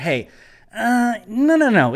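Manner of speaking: ordinary talk, nothing marked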